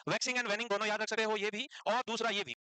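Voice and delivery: ordinary talk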